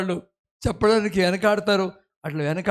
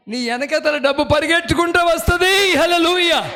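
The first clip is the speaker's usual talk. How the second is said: louder, higher full-force preaching; preaching hard; 9 semitones higher, 7 dB louder than usual. Acted. Preacher promising the congregation, loud and fervent. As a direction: preach it out with fervour.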